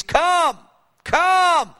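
high, loud cry of come; preacher's impassioned cry. Retold or imitated. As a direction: call out come with longing. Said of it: "crying out to Jesus".

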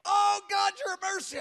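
high-pitched cry for forgiveness; imitated wailing. Pastor imitates the collapsed woman begging forgiveness, wailing. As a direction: mimic a woman wailing out in repentance. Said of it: "crying out that Jesus would forgive her".

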